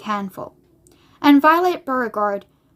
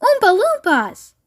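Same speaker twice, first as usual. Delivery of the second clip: high, drawn-out cry of amazement; storybook chorus of surprise; astonished group exclamation; 9 semitones higher, 3 dB louder than usual. A narrator's reading. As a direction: exclaim it slowly and high, as a whole crowd. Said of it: "everyone said at once".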